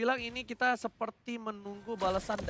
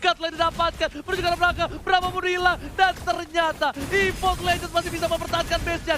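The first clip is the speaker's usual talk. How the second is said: high, loud hype call; fighting game announcer; 10 semitones higher, 10 dB louder than usual. Acted. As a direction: push it up for the comeback. Hype esports play-by-play.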